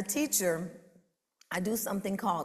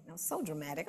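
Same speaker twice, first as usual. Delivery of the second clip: ordinary delivery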